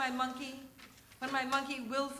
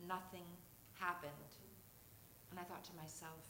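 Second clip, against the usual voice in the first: hushed dread; 5 semitones lower, 14 dB quieter than usual. Real, not acted. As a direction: whisper the dread to the room. Child fearing nightmare comes true.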